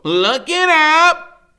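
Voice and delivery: high, loud, very slow order; commanding Freak. A narrator's reading. Freak ordering him to look it up, high slow loud. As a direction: spell out the order slowly, high. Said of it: "he says".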